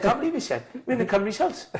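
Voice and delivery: very high, teary imitation, laughing through it; hammed-up tearful squeak; mock crying. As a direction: squeak out the news like a mate in tears. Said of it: "He starts crying".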